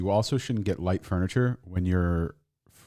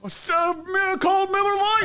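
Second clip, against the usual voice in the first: much higher, shouted mashup cry; hyped callout. Pretend. Friend mashing up Stone Cold and Miller Lite, high hyped yell. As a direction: yell it like a wrestling fan.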